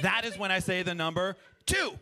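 usual speaking voice

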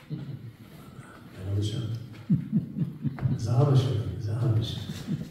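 sinking to a very soft murmur; hushed reverence. Retold or imitated. Old man explaining his closeness to God, hushed reverence. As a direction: let it fade into near silence. Storyteller dwelling on the punchline.

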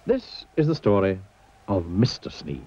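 usual speaking voice

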